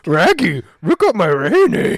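high, loud cartoon dog voice; Scooby-Doo voice. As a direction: say it like Scooby with R's.

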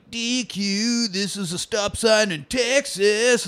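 a hint of a local TV spot; mock ad voice. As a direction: read it like a cheesy Texas ad, slowly. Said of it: "they have commercials like".

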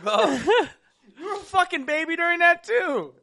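high, loud laughing tease. Real, not acted. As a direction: tease him while laughing. Teasing friend laughing.

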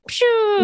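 high mock bomb whoosh; a playful high bomb noise; bomb sound effect. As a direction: spit out a quick pshoo like a bomb going off. Imitating a bomb dropping.

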